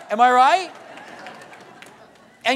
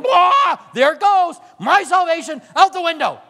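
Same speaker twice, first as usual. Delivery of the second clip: loud, high blow-up of temper; mock outburst; 8 semitones higher, 7 dB louder than usual. Preacher acts out blowing up at his kid, loud and high. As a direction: burst out loud and high as if losing it.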